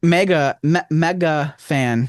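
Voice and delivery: "mega" said slowly with a clear "meg"; careful mega. Shown as a demonstration. Fixing his Rochester vowel for the cohost. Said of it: "It's a Rochester accent".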